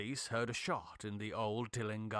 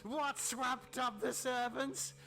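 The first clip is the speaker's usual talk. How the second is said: gloating, menacing madman, higher than the narrator; mad scientist; 8 semitones higher than usual. Acted. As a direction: taunt the trapped friend with cruel triumph. Tillinghast gloats and threatens.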